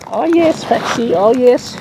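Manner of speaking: squeaky delighted oh yes; high coo. Real, not acted. Greeting birds.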